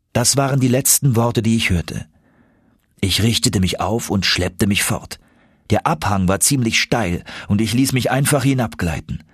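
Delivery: ordinary delivery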